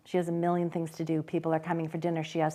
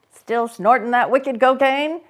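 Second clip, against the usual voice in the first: teasing; 8 semitones higher, 12 dB louder, slower than usual. A narrator's reading. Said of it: "Same old tease".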